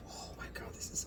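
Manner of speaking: stunned whisper. Real, not acted. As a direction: breathe it in awe. Tasting food, awed reaction.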